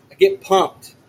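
normal talking voice

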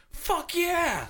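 high, drawn-out whoop; imitated holler. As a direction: holler it like the haunted-house guest. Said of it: "we kept hollering out".

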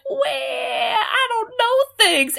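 high, slow, hammed-up whimper; mock crying. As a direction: blubber it like a sulking kid. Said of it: "Yul's like".

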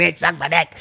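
put-on high-pitched kid parroting swears; mock child voice; excited son. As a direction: imitate a boy repeating dirty words. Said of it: "he's excited".

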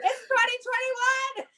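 high, laughing with relief; relieved laughter. Real, not acted. Relief that the year ended.

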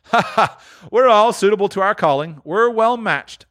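high cackle into the words; gleeful old fence laughing; laughing old Joe. Acted. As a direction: chuckle at his own joke. Joe laughs at own joke.

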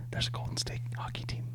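hushed, barely audible whisper; whispered thought. Retold or imitated. Confused co-host recalling his thought to friends, whispered. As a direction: whisper it like a private realization. Acts out own puzzled private thought.

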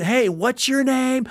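high, drawn-out mock yell; mock screaming. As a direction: imitate a pushy guy yelling in someone's face. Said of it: "why is he interface screaming".

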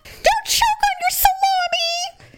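very high, drawn-out squeal; mock mad. Woman mocking the rude patron eating crackers and meat, mad. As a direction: squeal it slowly in mock fury. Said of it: "I was just so mad".

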